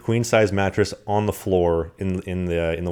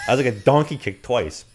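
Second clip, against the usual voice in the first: usual speaking voice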